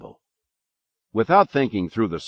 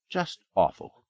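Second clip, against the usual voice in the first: put-on old woman's voice; 9 semitones higher than usual. A narrator's reading. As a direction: sniff it like a sour old woman. Missus Obst Schmecker's inner voice.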